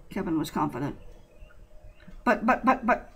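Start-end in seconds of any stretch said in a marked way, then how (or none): 2.2-3.1 higher, stammering confusion; stuttering. A narrator's reading. Confused Bobby stammers at Kevin's answer. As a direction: trip over the word in confusion. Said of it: "Bobby stuttered".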